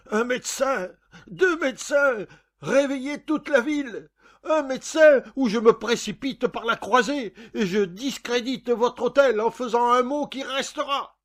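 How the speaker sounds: loud, high-pitched frantic shout; shouting for help. A narrator's reading. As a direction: scream for a doctor and threaten the whole hotel.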